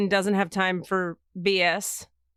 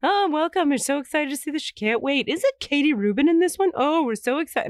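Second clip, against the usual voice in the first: higher, chirpy mock theatergoers; excited audience members; 6 semitones higher than usual. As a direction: lightly imitate eager fans filing in. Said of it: "and they're".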